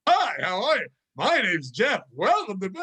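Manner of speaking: cheesy, exaggerated swooping sing-song; over-the-top greeting. Shown as a demonstration. Coworker showing how to greet customers, absurdly over the top. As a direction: ham up the welcome until it's insane. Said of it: "so over the top that it's insane".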